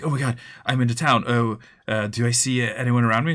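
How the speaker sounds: a wide-eyed first-timer gushing at the town; eager new player. Retold or imitated. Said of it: "they're like".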